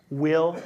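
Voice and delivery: "will" lifted and held firm; emphatic "will", no maybe about it. Shown as a demonstration. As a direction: lean hard on "will".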